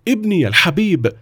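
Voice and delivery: high-pitched cry of relief; overjoyed father, voice pitched right up; relieved exclamation. A narrator's reading. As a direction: exclaim like a father embracing his rescued son. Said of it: "وهو يهتف".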